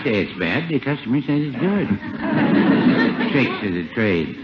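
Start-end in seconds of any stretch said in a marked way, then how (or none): 1.9-3.3 high breathy titter; high chuckle. Acted. Druggist pleased with his sales trick, high titter. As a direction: giggle at your own cleverness.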